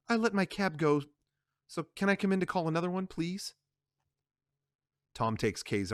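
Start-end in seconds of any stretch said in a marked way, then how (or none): 0.1-3.5 Kay asking Tom to let her in, higher and quick; pleading Kay. Acted. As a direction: rush the ask, fade the last word. Kay asks to come in.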